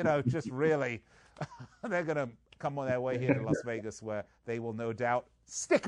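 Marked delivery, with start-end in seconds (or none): none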